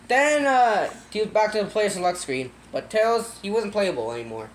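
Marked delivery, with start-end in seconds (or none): none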